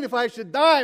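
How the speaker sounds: "If I should die?" thrown out loud and high in alarm; alarmed emphasis. Acted. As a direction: hit "die" like it just sank in.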